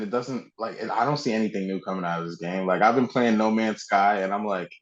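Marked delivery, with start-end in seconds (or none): none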